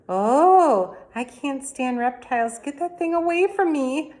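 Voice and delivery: high, loud disgusted shriek. A narrator's reading. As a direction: recoil from the reptile. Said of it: "Missus Yarby shrieked".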